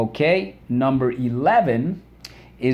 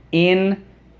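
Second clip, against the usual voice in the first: syllable by syllable; 8 semitones higher, 4 dB louder, slower than usual. Shown as a demonstration. Teacher leading the class through interrupt, syllable by syllable. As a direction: interrupt split as IN... ter... rupt, starting high. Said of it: "Let's go".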